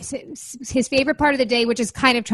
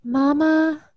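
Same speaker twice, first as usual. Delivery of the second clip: put-on a higher-pitched child calling for mom; lightly imitated little son; 5 semitones higher than usual. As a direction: lightly put on a kid's questioning call. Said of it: "he always has to go".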